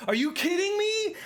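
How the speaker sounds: high-pitched, laughing outburst of disbelief; really excited. Real, not acted. Gamer who almost made the jump, excited shriek. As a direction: squeal in real exasperated excitement. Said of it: "I'm just really excited".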